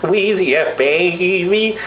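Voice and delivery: high, sustained, wavering rapper drawl. Acted. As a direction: imitate an auto-tuned rapper without the effect. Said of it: "talking in auto-tune".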